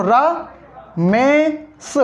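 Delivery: drawn-out, very slow Ramesh, syllable by syllable; slow syllable split. Shown as a demonstration. Teacher splitting a name for students, drawn out very slow. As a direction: stretch Ramesh out slowly to split it into pieces. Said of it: "Ramesh के टुकड़े करो".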